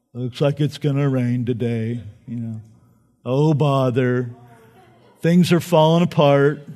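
mope like the donkey Eeyore; a gloomy Eeyore moan. Retold or imitated. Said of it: "am I gonna be like Eeyore".

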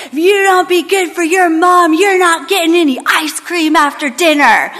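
high-pitched child mimicking a parent's threat; loud, animated son. Son quoting his dad's threat to the caseworker, high animated mimicry. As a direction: imitate an animated kid playing stern dad. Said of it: "this loud, animated voice".

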